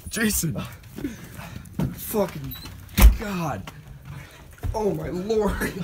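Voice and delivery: loud exasperated shouting; yelling. Real, not acted. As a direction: shout him back inside. Yelling at freezing friend.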